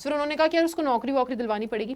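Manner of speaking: ordinary talk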